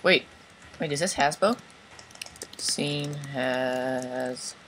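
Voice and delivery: ordinary delivery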